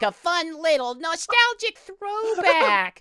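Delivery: high, drawn-out cartoon squeal of a voice; cartoony SpongeBob voice. Real, not acted. Exasperated Sonic fan arguing about a nostalgic ad, cartoony voice. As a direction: stretch it out high like a cartoon character. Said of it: "he started sounding like SpongeBob".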